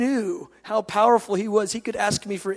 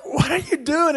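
alarmed dad; 6 semitones higher, 3 dB louder than usual. Retold or imitated. Dad's startled reprimand, acted.

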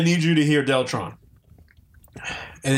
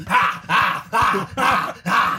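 mock triumphant laugh; 3 semitones higher, much slower than usual. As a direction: crow about surviving the spice. Bragging after eating hot sauce.

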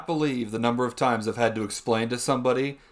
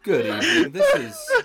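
greeting collapsing into laughter; real helpless laugh breaking the words; 4 dB louder than usual. Real, not acted. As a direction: try to stay professional and crack up. Fails to restart greeting, laughing.

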